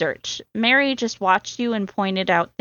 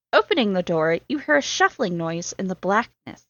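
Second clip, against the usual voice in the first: ordinary delivery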